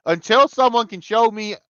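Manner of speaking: usual speaking voice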